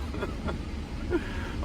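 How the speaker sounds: laughing. Real, not acted. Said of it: "Oh my God, I cracked myself up".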